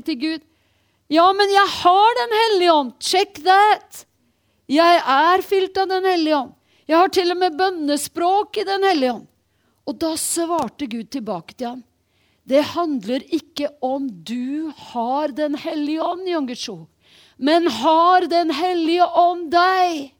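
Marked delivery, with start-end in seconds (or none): 1.1-9.3 higher, louder eager protest; protesting pastor. Retold or imitated. Pastor protests to God, voiced. 12.5-20.1 slow, weighty reply, last words louder; God's answer. Retold or imitated. God's answer voiced slowly.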